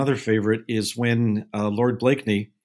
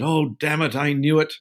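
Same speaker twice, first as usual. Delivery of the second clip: higher-pitched mock Essex outburst; lightly put-on Essex accent, exasperated; 5 semitones higher than usual. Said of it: "with his Essex accent".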